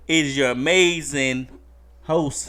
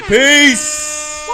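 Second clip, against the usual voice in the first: loud, high, drawn-out farewell shout; shouted sign-off; 12 semitones higher, 10 dB louder than usual. Real, not acted. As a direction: holler it out long and high to end the show.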